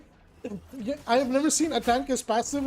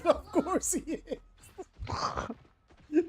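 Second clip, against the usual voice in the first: slow, laughing drawl; 5 semitones higher, 5 dB quieter, much slower than usual. Real, not acted. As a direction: draw it out through laughter. Laughing at teammate.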